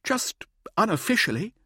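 high, wheedling request; pleading. A narrator's reading. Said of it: "Mirza pleaded".

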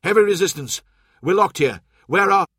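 loud, high and cut off; shouted battle report. A narrator's reading. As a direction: yell it over the fighting. Soldier shouting under fire, cut off.

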